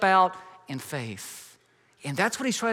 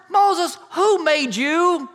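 God reminding Moses who made him, booming; 9 semitones higher, 7 dB louder than usual. Acted. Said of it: "In other words".

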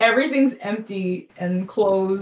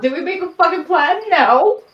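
loud, higher-pitched exasperated outburst; 8 semitones higher, 6 dB louder than usual. Acted. Character objects to teammate about planning, exasperated. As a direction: build to a punched, rising protest.

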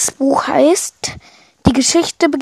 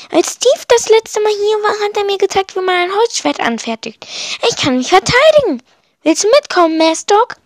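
put-on confident young Minus; 7 semitones higher than usual.

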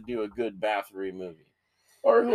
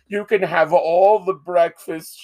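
slow, higher, louder mock host voice; voice of hell; 5 semitones higher, 6 dB louder, slower than usual. To his friend, picturing a hellish hotel breakfast: slow, higher mock host. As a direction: welcome the damned to the buffet, drawn out. Voicing hell's welcome at breakfast.

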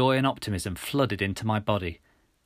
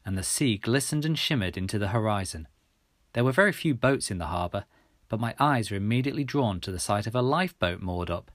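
usual speaking voice

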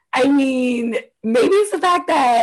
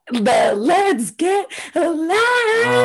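slow, stretched mock J Lo shout, higher; 7 semitones higher, much slower than usual. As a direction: drag out each word like a hammed-up diva. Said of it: "let's talk about J Lo".

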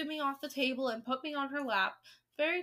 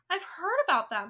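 higher and louder, eager outburst; excited cry; 6 semitones higher, 8 dB louder than usual. A narrator's reading. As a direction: burst out with thrilled excitement. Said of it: "cried out excitedly".

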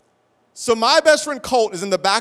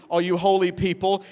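ordinary talk, nothing marked

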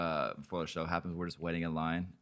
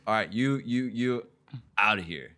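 louder, ordering them out; guard's shout; 3 semitones higher, 5 dB louder than usual. Retold or imitated. Security guard throwing the friends out, loud. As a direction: bark them out of the line as the guard. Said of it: "And he goes".

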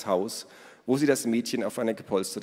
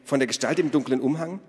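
raised, eager follow-up; excited question; 6 semitones higher, 4 dB louder than usual. A narrator's reading. Ada asks Elos about the stranger, excited. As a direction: keep asking in a high, eager voice. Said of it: "fragte Ada aufgeregt".

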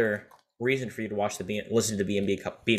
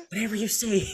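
high Joker attempt on the edge of laughter; 9 semitones higher, 3 dB quieter than usual. Podcaster retrying an impression while fighting giggles, Joker voice. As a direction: restart the Joker line, pitched up. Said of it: "my Joker voice is better".

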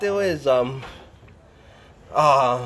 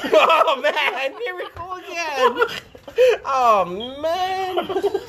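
laughing; 9 semitones higher, slower than usual. Real, not acted. Laughing after the slap.

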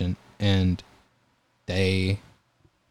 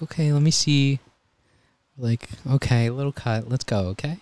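put-on higher, gentle kid-directed voice; talking to a child; 5 semitones higher than usual. EMT looking at a little girl's cut, higher and gently upbeat. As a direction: cheerfully reassure a small girl.

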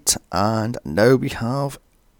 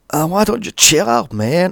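mock eighties California dude; bad Californian accent; 6 semitones higher than usual. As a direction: quote it in a cheesy Californian accent. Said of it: "as bad as that Californian accent".

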